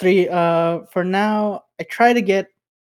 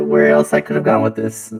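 voice changer; 7 semitones lower than usual. Real, not acted. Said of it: "I'm testing out with a voice changer".

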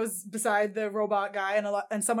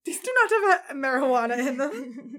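Dutch shopkeeper voice; 8 semitones higher, 4 dB louder than usual. Retold or imitated. Shopkeeper voiced in movie retelling.

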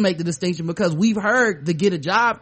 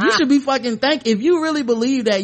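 higher-pitched, half-laughing indignation; mock angry retort; 6 semitones higher than usual. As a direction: play up being mad back. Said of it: "that's always in the height of anger".

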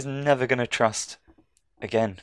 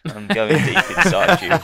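high, louder, leering mock voice; mock creepy line; 7 semitones higher, 4 dB louder than usual. Joking about Bernard, in a leering mock voice. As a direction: ham it up like a creepy villain.

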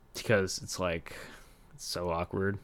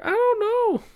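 squeaky, far higher strained whine; mock helpless wail; 8 dB louder than usual. Quoting himself unsure about the restroom, squeaky mock wail. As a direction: squeal it like a cartoon of despair. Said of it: "You know, I'm just like".

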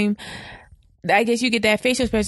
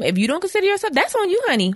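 sassy; 9 semitones higher than usual. Retold or imitated. Sassy jab at ignored people.

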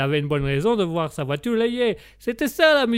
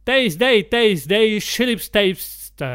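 put-on loud, laboured Italian-accented English; 3 semitones higher, 4 dB louder, much slower than usual. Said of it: "l'accent anglais italien".

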